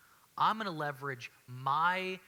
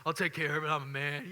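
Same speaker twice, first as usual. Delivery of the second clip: a hint of stubborn macho brush-off; mock stubborn guy; 5 dB louder than usual. Husband brushing off his wife's offer, mock macho. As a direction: play the proud husband refusing help, lightly. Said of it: "we're like".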